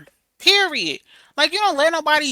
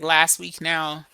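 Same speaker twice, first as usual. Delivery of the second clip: ordinary talk, nothing marked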